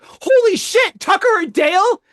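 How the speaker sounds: high, loud thrilled yell; excited shout. Retold or imitated. Hearing the movie title on the phone, an excited shout. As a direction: blurt it out in sheer delight. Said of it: "I'm like".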